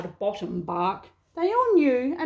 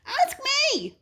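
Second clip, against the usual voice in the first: much higher squeaky little voice; small Numbat. Acted. Little Numbat begs the others to ask him, high and eager. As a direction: pipe up like a tiny eager animal.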